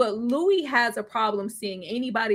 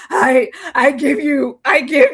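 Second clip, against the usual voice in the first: mock retching voice; 4 semitones higher, 8 dB louder than usual. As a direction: choke the words out. Acting out vomiting Lestat.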